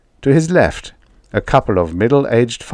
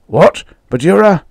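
shocked farmer; 10 semitones higher, 6 dB louder than usual. Acted.

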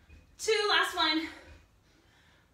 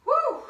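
high loud cheering whoop; excited whoop; 10 semitones higher, 6 dB louder than usual. Real, not acted. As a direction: whoop to pump everyone up. Cheering mid workout.

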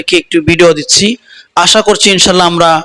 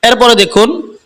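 ordinary talk, nothing marked

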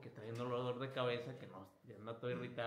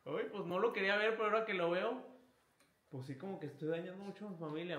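remorseful; 4 semitones higher, faster than usual. Retold or imitated. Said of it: "puede venir inclusive hasta con lágrimas".

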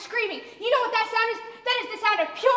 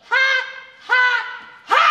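forced loud ha ha ha; 3 semitones higher, 6 dB louder than usual. Acted. Forced laughter to prove a point.

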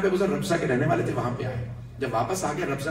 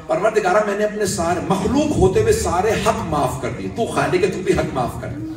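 loud, rising plea to God in full sermon force; a father begging God aloud, preached at full force; impassioned preaching; 5 semitones higher, 5 dB louder than usual. Acted. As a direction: cry out the prayer over the grave. Prayer quoted again at climax.

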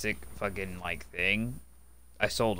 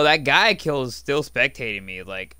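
ordinary delivery

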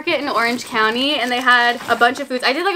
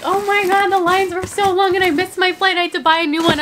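1 mock traveler; 7 semitones higher than usual. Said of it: "everyone being like".

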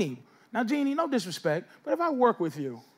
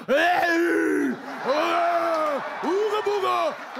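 mock crude harassment; 6 semitones higher, much slower than usual. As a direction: holler like an exaggerated catcaller. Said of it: "I'm not talking about".

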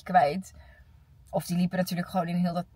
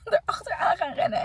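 laughing; 10 semitones higher than usual. Real, not acted. Laughing while telling she ran.

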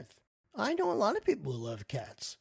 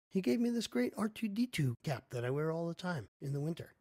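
normal talking voice

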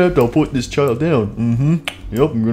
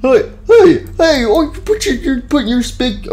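indignant shout; 9 semitones higher than usual. Acted. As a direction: yell at the rude guest. Feet on tables, indignant.

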